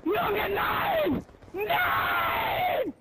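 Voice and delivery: high shrieking rage; raging scream. Real, not acted. Gamer dies in the match and screams high in rage. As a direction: scream as if you just got killed.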